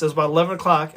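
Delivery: ordinary talk, nothing marked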